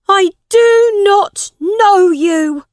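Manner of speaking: slow, high, loud denial; shouting. A narrator's reading. New king disowns his mother as guards take her, shouting. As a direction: shout it word by word, drawn out. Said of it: "Tom shouted at his mother".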